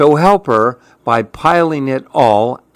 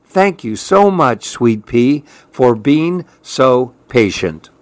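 plain reading voice

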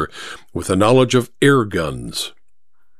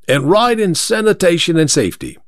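higher-pitched London driver's sales pitch; rival cabbie; 4 semitones higher than usual. Acted. Second driver sells his clean cab, touting. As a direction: tout your cab in a cabbie's voice.

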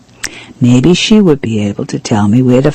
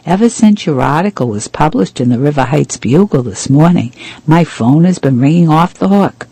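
ordinary delivery, nothing marked

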